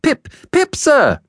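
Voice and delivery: put-on very high, timid child; high-pitched nervous reply; scared little boy. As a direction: answer in a small frightened boy's voice. Scared boy answers convict.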